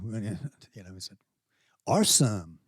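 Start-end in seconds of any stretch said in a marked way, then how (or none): 1.9-2.6 imitated San Diego "awesome"; San Diego accent. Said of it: "do a San Diego accent".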